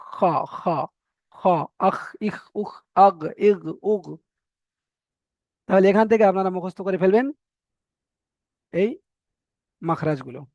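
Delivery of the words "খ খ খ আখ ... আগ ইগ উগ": খ and আখ ইখ উখ rasped near the mouth; guttural demo. Shown as a demonstration. Teacher to students, guttural খ demo. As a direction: scrape খ at the top of the throat. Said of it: "গলার কাছ থেকে".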